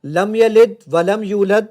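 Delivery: slow, loud, careless recitation meant to be marked wrong; deliberately wrong recitation. Shown as a demonstration. Testing the app, he recites wrongly on purpose, slow and loud. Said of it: "میں اس کو غلط پڑھوں گا".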